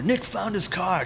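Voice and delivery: imitated tabloid show announcer; mock TV headline. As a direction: announce a follow-up story, hammed up. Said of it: "they did a follow-up story".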